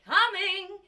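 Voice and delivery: high bright call from offstage. Acted. Witness answering the court's call loudly. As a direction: sing out your arrival.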